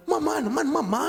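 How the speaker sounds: high, loud mock complaint; protesting mother. Mother wanting to be baby's first word, mock protest. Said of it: "Sabi nung nanay".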